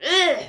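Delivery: loud mock kid's groan; kids' ugh. Mimicking the kids on bicycles with a loud ugh. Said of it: "these kids were going".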